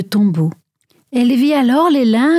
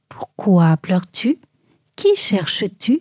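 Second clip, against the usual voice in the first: lower, slow, hushed whisper; gentle whisper; 5 semitones lower than usual. A narrator's reading. Jesus to weeping Marie, slow low whisper. Jesus speaks from behind her.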